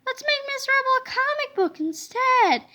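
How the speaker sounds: put-on excited boy. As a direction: pitch up for a scheming kid. George voiced, boy.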